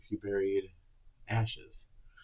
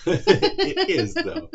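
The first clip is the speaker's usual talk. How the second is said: high and loud through laughter; laughing; 7 dB louder, much slower than usual. Real, not acted. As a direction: still giggling as he speaks. Laughing reply about funny prank.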